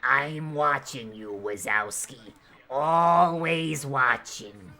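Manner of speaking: slow, low raspy slug lady voice. Retold or imitated. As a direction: drag out each word like the smoker slug lady. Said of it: "sound like the slug lady from Monsters, Inc".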